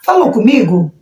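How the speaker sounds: acted double-take question; questioning expression. Shown as a demonstration. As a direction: react as if unexpectedly spoken to. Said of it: "É a minha expressão".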